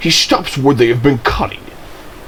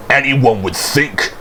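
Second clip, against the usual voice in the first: plain reading voice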